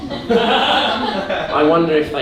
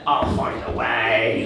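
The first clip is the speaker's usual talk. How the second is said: lower, slow sinister threat; the bowels answer the performer, slow low menace; ominous bowel voice; 4 semitones lower, 4 dB quieter, slower than usual. Acted.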